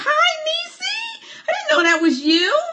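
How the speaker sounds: very high-pitched delighted squeal; excited greeting. Real, not acted. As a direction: squeal hello to a friend you didn't expect. Delighted greeting of a newly recognized viewer.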